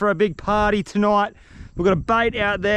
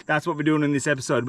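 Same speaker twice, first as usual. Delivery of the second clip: ordinary delivery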